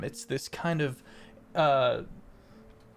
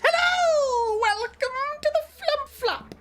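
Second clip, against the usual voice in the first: portly halfling barker; 11 semitones higher than usual. Acted. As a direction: hawk the game like a jolly fairground halfling. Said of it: "a very portly, rotund halfling goes".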